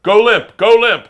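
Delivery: loud, high urgent command; loud urgent shouted order. Retold or imitated. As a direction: trainer yelling instructions. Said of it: "he just starts going".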